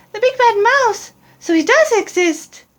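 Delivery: put-on startled young monster; Gruffalo's child. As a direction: gasp it out in high fright. Child reacts with alarm.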